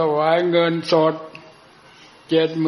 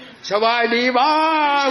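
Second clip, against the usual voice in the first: drawn-out, higher hollered greeting; mock loud greeting; 8 semitones higher, 3 dB louder, slower than usual. Two monks greeting a deaf abbot, mock loud holler. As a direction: holler a greeting to a deaf old monk, slowly. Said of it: "เสียงลั่นขึ้น".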